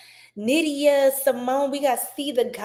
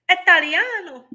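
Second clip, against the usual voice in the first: Italiano with a bright Italian lilt, louder and higher; Italian flourish; 6 semitones higher, 5 dB louder than usual. Pretend. Greeting a viewer, a playful Italian flourish. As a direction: roll out Italiano like a proud Italian.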